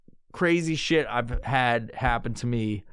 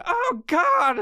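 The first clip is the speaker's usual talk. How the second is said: mock panic; 3 dB louder than usual. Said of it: "I was like".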